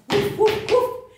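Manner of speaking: medium dog barking. Shown as a demonstration. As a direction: bark like a middle-sized dog. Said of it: "the middle-sized dog".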